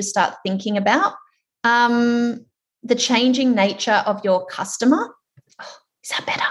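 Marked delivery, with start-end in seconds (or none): 5.6-6.4 quick whispered check to the side. Real, not acted. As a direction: whisper it off-mic as an aside. Checking audio mid-webinar.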